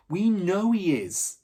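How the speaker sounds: slow, loud emphatic; firm insistence. Acted. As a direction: lean hard on it, certain.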